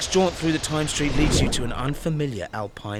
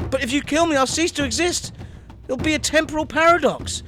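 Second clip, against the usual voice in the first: higher, nervous pleading; higher frightened tech boss; Zuckerberg voice; 8 semitones higher than usual. Acted. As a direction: squeak out a panicked plea. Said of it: "you're saying".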